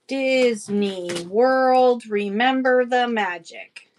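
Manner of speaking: very slow, stretched-out words; laboured, elongated slogan reading; drawn-out reading. Real, not acted. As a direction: read it out syllable by syllable.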